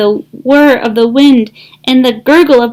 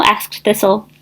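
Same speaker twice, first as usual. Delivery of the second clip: ordinary delivery, nothing marked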